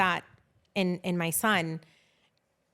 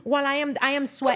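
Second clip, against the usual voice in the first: normal talking voice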